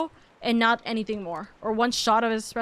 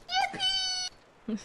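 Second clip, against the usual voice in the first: very high, soft cartoon squeak; tiny falsetto cheer; squeaky yippee; 8 dB quieter than usual. Cheering with toy.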